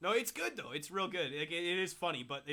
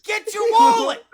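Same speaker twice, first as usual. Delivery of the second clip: high, slow, loud mock yell; mock shout; 16 dB louder, slower than usual. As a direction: holler it as in a retold drunken scene. Mock shouting in drunk-friend story.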